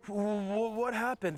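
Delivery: high, stammering daze; dazed confusion. Retold or imitated. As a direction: stammer out the question, high and lost. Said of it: "I'm like".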